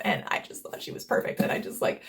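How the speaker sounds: breathless whispered adoration; hushed, starry-eyed whisper; gushing whisper. Real, not acted. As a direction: whisper it like a secret crush. Gushing about the actress.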